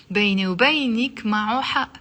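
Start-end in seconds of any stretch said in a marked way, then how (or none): none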